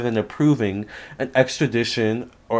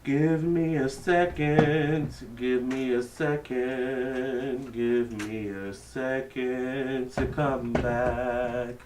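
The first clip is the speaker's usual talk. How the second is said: distant, slow and muffled off-mic; calling from across the room; far from mic; 11 dB quieter, much slower than usual. Real, not acted. Away from mic changing battery.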